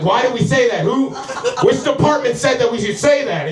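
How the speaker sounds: high-pitched, panicked control-room crew; miniature selves. Retold or imitated. Tiny Travises at a missile silo, high mock alarm. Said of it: "a bunch of miniature Travises in my fucking".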